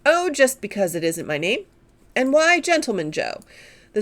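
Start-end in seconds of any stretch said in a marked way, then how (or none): none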